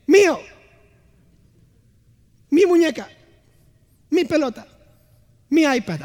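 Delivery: put-on high, loud possessive child claiming toys, fading down; bratty child. Preacher imitating his daughters fighting over toys, lightly voiced. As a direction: whine like a kid grabbing toys. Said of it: "¿Y qué dicen?".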